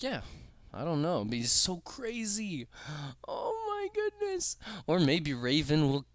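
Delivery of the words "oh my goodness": high gasp, slow oh my goodness; breathless shock. Real, not acted.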